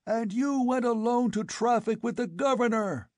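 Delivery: high frenzied accusing yell; furious yell. A narrator's reading. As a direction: work into a frothing frenzy. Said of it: "yelled the Afghuli".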